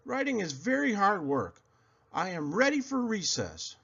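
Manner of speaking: put-on high, sulky child voice; whiny kid complaint. A narrator's reading. Girl complaining to herself about her new class, high and whiny. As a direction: whine like a fed-up six-year-old.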